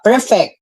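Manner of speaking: Perfect said high, set apart; announcing example. Shown as a demonstration. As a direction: present the word Perfect as a new example. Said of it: "Ano pa?".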